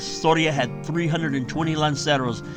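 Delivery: ordinary delivery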